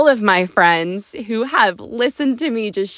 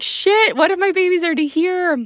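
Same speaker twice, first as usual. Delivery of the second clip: mock panic; 9 semitones higher than usual. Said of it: "you're thinking, like".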